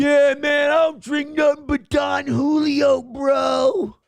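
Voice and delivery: loud, high mocking bro shout. Comedian mocking the passenger, loud high bro shout. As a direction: yell it like a poser douche.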